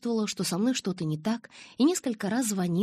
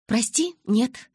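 slow, louder, higher refusal; resolute; 6 semitones higher, 6 dB louder, much slower than usual. A narrator's reading. Girl ending Newton's last hope, decisive. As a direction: refuse with firm finality. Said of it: "решительно сказала я".

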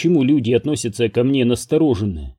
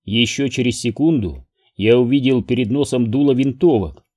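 ordinary delivery

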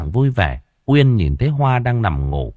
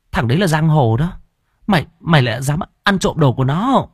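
higher-pitched, stammering alarm; alarmed disbelief; 6 semitones higher than usual. A narrator's reading. Hoa fears her roommate robbed a gangster, alarmed. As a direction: question her as if she has done something reckless.

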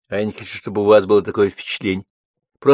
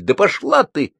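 snapping; 9 semitones higher than usual. Acted. Ссора, Лёля огрызается.

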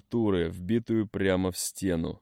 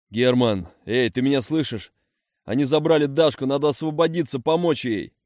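loud higher urgent plea; urgent calling; 5 semitones higher, 6 dB louder than usual. A narrator's reading. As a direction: rouse him, urgently. Urgently calling wounded comrade.

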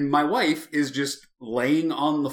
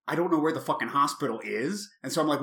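normal talking voice

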